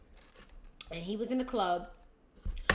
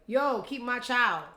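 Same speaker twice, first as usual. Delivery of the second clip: mock casual demand; 4 semitones higher, 6 dB louder, slower than usual.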